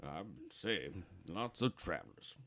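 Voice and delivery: put-on soft, low old drinker; musing quietly into the glass; old regular trailing into his whiskey; old barfly after a sip. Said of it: "he takes a big sip".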